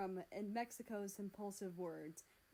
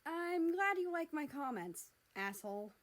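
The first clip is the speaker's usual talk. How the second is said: sarcastic snap; 7 semitones higher, 6 dB louder than usual. Acted. America sarcastic retort.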